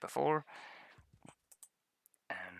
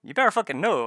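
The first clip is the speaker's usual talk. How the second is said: higher, louder mock warning; mock threat; 8 semitones higher, 11 dB louder than usual. Imitating Ken threatening the player, mock angry. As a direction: bark a joking threat. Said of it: "He's like".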